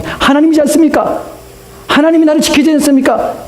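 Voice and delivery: high-pitched challenge to God; demanding protest. Retold or imitated. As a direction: press the questions high, as an imagined complainer. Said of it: "이렇게 요구할 수 있습니다". (높은 어조로 따지듯 요구하는)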